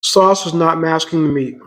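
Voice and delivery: normal talking voice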